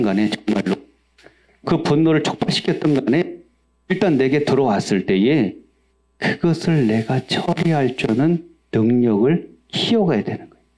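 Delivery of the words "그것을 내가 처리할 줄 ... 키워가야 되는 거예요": normal talking voice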